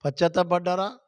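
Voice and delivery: usual speaking voice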